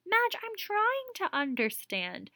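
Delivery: mother pleading with her daughter; pleading old mother. Acted. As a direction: gently insist you are trying. Voicing the mother.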